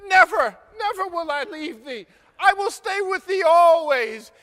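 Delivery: high, loud, fervent vow; vehement vow. Acted. Ruth refuses to leave Naomi, loud fervent vow. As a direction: swear it with full force. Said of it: "But Ruth says".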